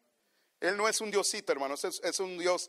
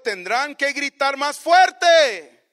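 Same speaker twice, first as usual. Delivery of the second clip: mocking; 6 semitones higher, 9 dB louder, slower than usual. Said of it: "se mofaba".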